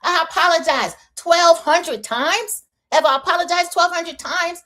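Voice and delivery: whiny high imitation; mocking Liz. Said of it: "Liz is like".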